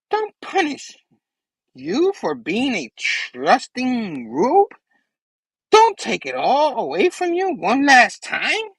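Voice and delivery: high, slow, swooping villain voice; Goblin. Acted. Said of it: "And the goblin's like".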